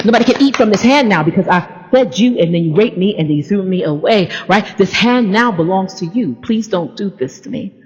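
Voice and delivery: ordinary talk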